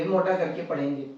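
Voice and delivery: usual speaking voice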